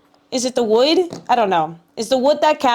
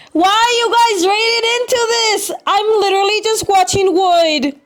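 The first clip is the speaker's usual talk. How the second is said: excited squeal; 8 semitones higher than usual. Acted. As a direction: squeal it in mock outrage. Streamer exclaims at raiders arriving.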